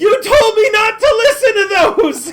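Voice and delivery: high wailing scream, half laughing. Acted. NPC screaming at the party, high and wailing. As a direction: shriek it, near tears. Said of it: "he screams".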